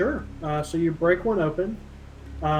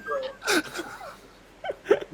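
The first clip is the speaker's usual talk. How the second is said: high words breaking into laughter; real laughter. Real, not acted. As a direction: try to speak through helpless laughing. Game master laughing at the lick.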